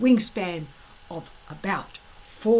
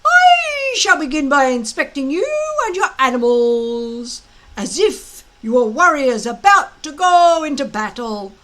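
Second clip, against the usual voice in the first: loud, high, gloating drill-master voice; a big blustering Viking instructor gloating at his recruits; booming Gobber; 7 semitones higher, 8 dB louder than usual. Acted. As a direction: lord it over the boys with relish.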